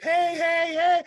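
loud, high calls of hey; people calling out. Retold or imitated. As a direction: call out to a star across the hall. Crowd calling out to him.

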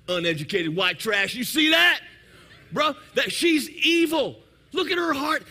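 loud, rising judgmental outburst; hammed-up indignation, climbing high and loud; mock outrage. Offended man judging her post, acted loud outrage. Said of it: "I'm gonna say something along the lines of".

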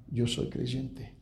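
quietly and very slowly; slow, soft confession. Retold or imitated. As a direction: say it softly, with weight. Grieving father's quiet statement.

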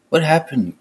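a sharp, high alarmed question; cried out. A narrator's reading. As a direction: call out in sudden alarm, voice jumping up. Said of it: "cried Fisk".

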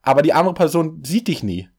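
ordinary delivery

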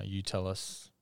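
ordinary delivery

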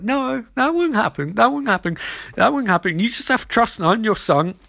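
high-pitched mock pleading son; imitating an earnest, insistent reassurance; urgent reassurance. Acted son reassuring dying mum.

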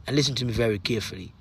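low, soft, intimate voice over the music; hushed motivational talk. Acted. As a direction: lean in close and speak softly to one listener. Quiet intimate stretch over music.

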